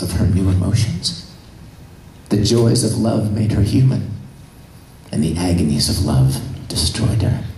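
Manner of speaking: low, measured, grave explanation; solemn Spock. Retold or imitated. Spock explaining her death to two men, acted solemn delivery. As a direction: lay it out gravely, pausing between phrases.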